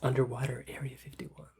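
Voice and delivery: hushed, mysterious whisper. Pretend. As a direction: breathe it like a secret.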